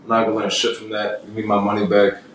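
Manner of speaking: ordinary delivery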